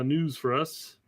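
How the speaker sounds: normal talking voice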